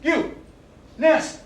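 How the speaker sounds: high, loud call across the ward; loud call for the nurse. Acted. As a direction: shout to get the nurse's attention. Calling out to the nurse.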